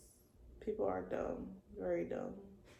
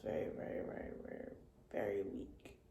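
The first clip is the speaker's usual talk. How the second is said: much softer, whispered weakness; fading to a whisper; 12 semitones lower, 11 dB quieter than usual. Real, not acted. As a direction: let the voice drain away. Describing being very weak.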